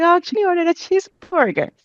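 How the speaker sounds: high, quick, small embarrassed voice; sheepish reply. Retold or imitated. She tells the waitress about the cheeseburger, high and sheepish. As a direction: rush it out apologetically. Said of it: "And I'm like".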